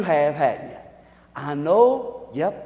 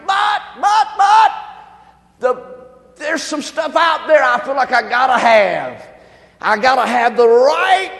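higher, louder cry of wanting more; frustrated outburst; 5 semitones higher, 7 dB louder than usual. Retold or imitated. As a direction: shout out the craving, then let it drop. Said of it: "we feel like".

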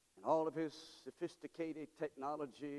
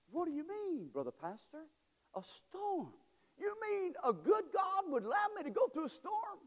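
high, indignant protest at God; a hammed-up shocked churchgoer; mock-incredulous believer; 4 dB louder than usual. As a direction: play the doubter who can't believe it. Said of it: "questioning God in a storm".

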